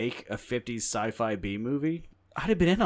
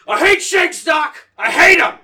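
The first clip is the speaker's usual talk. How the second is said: much higher, much louder shouted Indy; Indiana Jones impression; 18 dB louder than usual. Shown as a demonstration. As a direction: yell it like Indy shouting at Doc. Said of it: "I can do a pretty good Indiana Jones".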